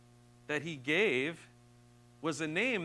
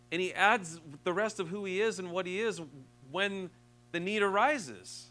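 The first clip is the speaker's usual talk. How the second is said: louder, higher, driving sermon voice; full-force preaching; 4 semitones higher, 6 dB louder than usual. Acted. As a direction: preach it with rising passion. Preaching climax about God's name.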